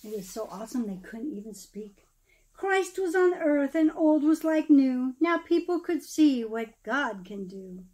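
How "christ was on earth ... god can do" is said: lively storybook read-aloud, rising and falling; storyteller reading verse aloud; reading to children. Acted. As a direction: read the Christmas rhyme to a room of kids. Reads Christmas poem to children.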